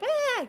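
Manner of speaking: short high-pitched "ah" of horror; mock shriek. Onlookers seeing the gore and gauze, mock shriek. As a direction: imitate a startled onlooker's shriek. Imitating people reacting to gore.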